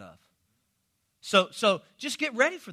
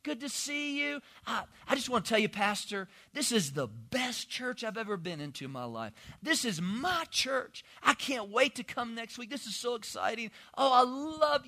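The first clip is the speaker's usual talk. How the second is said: lightly voiced adoring fan of the pastor; gushing flatterer; 4 semitones higher, 5 dB quieter than usual. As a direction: lay the flattery on thick. Pastor imitates a gushing congregant.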